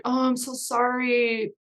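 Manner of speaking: high, slow, drawn-out fake apology; stretched-out mock regret, pitched high. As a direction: imitate a prankster pretending to be so sorry. Fred's mock apology to turned-away fans.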